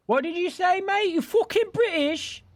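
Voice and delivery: cartoon British outrage; mock British accent. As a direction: squawk it like a riled-up cockney.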